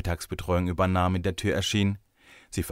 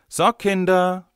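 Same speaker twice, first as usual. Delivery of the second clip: high, loud, drawn-out teacher's call; 11 semitones higher, 7 dB louder, slower than usual. A narrator's reading. Teacher calls the children to tidy up, calling out. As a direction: call the whole group to attention. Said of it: "rief sie".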